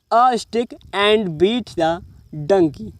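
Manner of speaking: very slow, word by word; slow reading aloud. Shown as a demonstration. Teacher reading the story line slowly to students. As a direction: read like dictation. Teacher reads English sentence.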